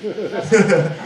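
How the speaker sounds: brief self-amused laugh; short chuckle. Real, not acted. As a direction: laugh a little at yourself. Laughs at own angry outburst.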